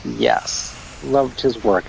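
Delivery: ordinary talk, nothing marked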